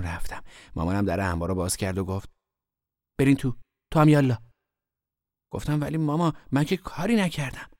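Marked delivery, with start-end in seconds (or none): 3.2-4.4 loud, raised command; stern order. A narrator's reading. Mother sending children into the storeroom, stern order. 5.8-7.7 put-on high-pitched child's protest; protesting innocence. A narrator's reading. Boy being punished by mother, protesting innocence.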